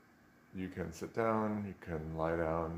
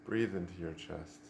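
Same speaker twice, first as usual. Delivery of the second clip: soft, unhurried meditation voice with long pauses; guided meditation; 8 dB quieter than usual. Real, not acted. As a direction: gently guide them into stillness, leaving space after each instruction.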